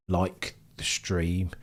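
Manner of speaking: like the stream drawn out slowly; slow, stretched repeat. Shown as a demonstration. Streamer stressing the ask to viewers, said slowly. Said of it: "If you like the stream".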